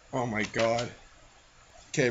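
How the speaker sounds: ordinary talk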